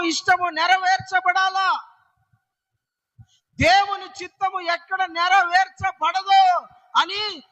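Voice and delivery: fervent pleading sermon voice; impassioned preaching. Acted. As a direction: plead with the Lord, asking why he weeps. Preaching at full force.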